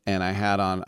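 normal talking voice

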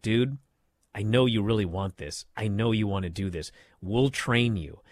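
a hint of a supportive coach; gentle coaxing. [name] coaxing Tony.

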